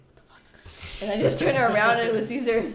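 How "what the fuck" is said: hushed under-breath whisper; whispered shock. Retold or imitated. [name] hearing her name in Target, stunned whisper. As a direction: mouth it almost silently in surprise. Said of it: "I was like".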